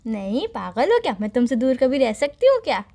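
filmy melodramatic declaration of love; dramatic punchy hero lines. Acted. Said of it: "फिल्मी dialogue". (फ़िल्मी अंदाज़ में भावुक डायलॉग)

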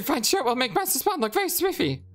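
high, stammering rat shopkeeper. Acted. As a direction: squeak it out like a nervous rat. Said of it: "rat voice".

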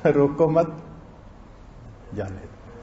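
long break after मत; pause after मत. Shown as a demonstration. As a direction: join रोको to मत, then drop well down on जाने दो. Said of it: "सिर्फ अंदाज के बदलने से".

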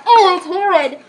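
loud grossed-out groan; disgusted. Real, not acted. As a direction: recoil at the awful taste. Disgust after tasting.

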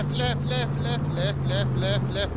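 high urgent repeated calls; frantic directions. Real, not acted. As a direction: bark directions in rising panic. Shouting directions to driver.

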